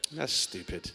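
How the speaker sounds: usual speaking voice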